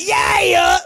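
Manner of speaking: high, loud "yeah, yeah"; Atlanta-style hype shout. Shown as a demonstration. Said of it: "supposed to say it like".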